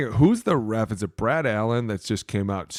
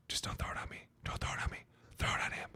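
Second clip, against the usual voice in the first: whispered plea to the ref; 15 dB quieter, faster than usual. Retold or imitated. Player begging the ref under his breath, whispering. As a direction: whisper it so only the ref can hear.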